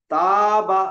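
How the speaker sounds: ताबा said loud with the long aa stretched; drawn-out taaba. Shown as a demonstration. Teacher demonstrating ताबा to students, long vowel. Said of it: "अब मैं दो चार हर्फ पढ़ के सुनाता हूँ".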